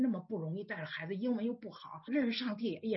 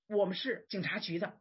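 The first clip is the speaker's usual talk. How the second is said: normal talking voice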